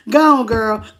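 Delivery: laughing. Real, not acted. Laughing at a viewer comment.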